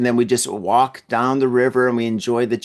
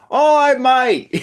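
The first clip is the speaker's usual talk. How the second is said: put-on high, loud, drawn-out Aussie accent; horrible Australian accent; 13 semitones higher, 5 dB louder, slower than usual. Host imitating Australian tourists outside, horrible Australian accent. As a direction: do a hammed-up, bad Australian accent. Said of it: "That's my horrible, horrible Australian accent".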